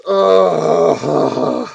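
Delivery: long groan of dread; weary "ugh" of dread; exasperated groan. Real, not acted. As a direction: groan it out, drawn and fed up. Dreading the next part.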